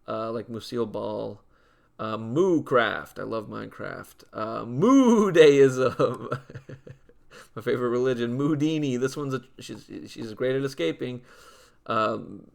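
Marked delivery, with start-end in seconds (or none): 4.7-7.6 laughing. Real, not acted. Laughing at own pun name.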